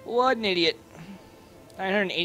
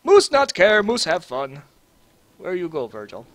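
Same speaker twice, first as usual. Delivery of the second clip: put-on caveman Russian accent. Gamer voicing his character Moose in a mock Russian accent. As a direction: talk like a simple Russian brute in a Russian accent. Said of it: "Moose think maybe sometime he have Russian accent".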